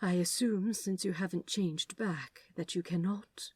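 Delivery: soft near-whisper; hushed. Acted. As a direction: ask it quietly and carefully. Angel asks quietly.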